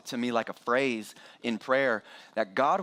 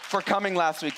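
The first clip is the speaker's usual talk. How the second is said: higher, brighter shout-out to viewers; enthusiastic thanks; 9 semitones higher than usual. Real, not acted. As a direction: beam it to the online guests. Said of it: "I hope they're watching online".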